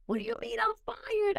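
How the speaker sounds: shocked disbelief. Retold or imitated. Shocked retold reaction.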